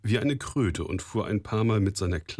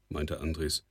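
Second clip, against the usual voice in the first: plain reading voice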